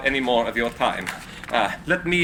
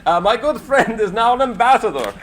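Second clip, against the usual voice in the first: laughing delight; 7 semitones higher, 3 dB louder than usual. Acted. Tesla delighted at dinner.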